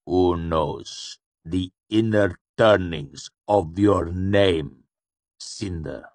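slow schoolmaster reciting a lesson; measured, cold catechism; slow patience. A narrator's reading. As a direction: drill the question patiently, word by word. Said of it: "spoken with a slow patience, like a schoolmaster".

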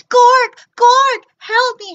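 shrill high shout for help; crying for help. Acted. Bork screaming to Gork for help, high and loud. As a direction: scream for your brother across space. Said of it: "she cried".

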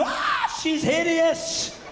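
high, slow, disgusted doctor voice; mock doctor. Doctor recoiling at a newborn, mock disgust. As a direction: recoil at the baby in disgust, drawn out.

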